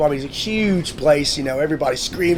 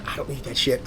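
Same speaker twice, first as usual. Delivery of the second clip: low, hushed retold refusal; confidential aside; 4 semitones lower, 8 dB quieter than usual. Guest leans over to refuse special treatment, low and quiet. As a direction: drop it under your breath.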